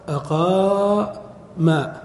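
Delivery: "أقام" with a long held "aa", then "ma"; waw turned to alif. Shown as a demonstration. Teacher showing the waw became alif, "أقام" with long held aa. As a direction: stretch the alif in "أقام" slowly. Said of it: "ثم قلبوا الواو ألفا".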